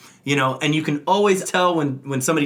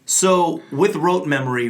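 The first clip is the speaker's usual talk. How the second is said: ordinary delivery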